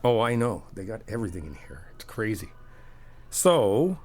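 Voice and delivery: ordinary talk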